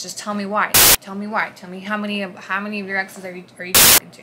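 loud, insistent demand for answers; retold angry demand. Jealous husband loudly demanding answers. Husband pressing her during argument.

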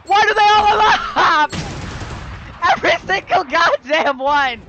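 loud, urgent warning; frantic yelling. Real, not acted. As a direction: shout to stop them. Yelling at teammates.

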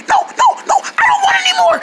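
high, hoarse panicked refusal; lost voice. Acted. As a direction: plead through a ruined voice. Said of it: "my voice sounding like this".

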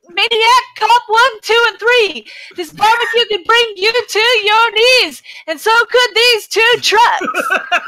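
deadpan spoken reading of a silly song; solemn lyric recital with a straight face; recited song lyrics. Pretend. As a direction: read the lyrics straight-faced like a poem.

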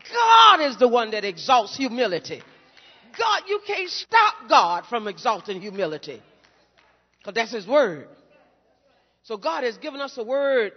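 loud preaching building to shouts; preacher at full cry before the congregation; shouted preaching. Acted. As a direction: lift it into a full pulpit shout.

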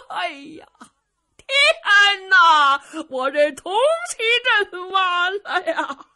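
high, loud, drawn-out lament; an old man crying out that all is lost; wailing over the ruined formation; despairing wail. Acted. Old prince sees his defeat.

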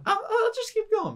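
high squeaky mocking repeat; teasing high echo of a friend's order.

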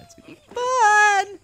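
sudden high, loud squeal; hyped falsetto cheer; excited squeal. Real, not acted. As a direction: burst out in a shrill-free high cheer. Hyped outburst after plain talk.

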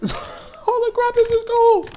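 very high, laughing exclamation; thrilled squeal. Real, not acted. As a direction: squeal it high in sheer delight. Thrilled while climbing in game.

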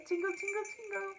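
high, lilting jingle imitation; playful bell jingling. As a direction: chime the words like little bells. Mimicking the jingle bells.

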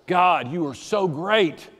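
slow, fuller, sincere prayer; earnest slow worship; fervent praise. Retold or imitated. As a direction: lift the praise aloud. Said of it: "I remember".

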